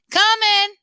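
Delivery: high, loud call to nobody; calling out to an imaginary caller, loud and high. Acted. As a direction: shout to an unseen sister far away. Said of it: "he called out into thin air".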